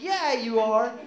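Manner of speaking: high, loud encouraging mom; cheery mom. Retold or imitated. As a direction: gush like a supportive mother. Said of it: "she'd be like".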